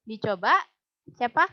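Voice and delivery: usual speaking voice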